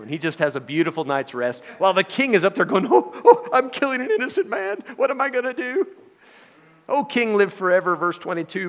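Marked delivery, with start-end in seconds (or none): 2.9-5.9 high, loud, fretful wailing; panicked king. Pretend. The king fretting through the night over Daniel, high and panicked. 6.9-8.0 calling up. Acted. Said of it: "repeated call to king".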